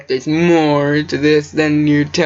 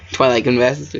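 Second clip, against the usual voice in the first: ordinary delivery, nothing marked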